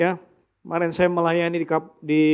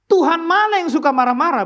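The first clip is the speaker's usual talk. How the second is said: rhetorical challenge; 9 semitones higher, 4 dB louder than usual. Real, not acted. Preacher challenging the congregation.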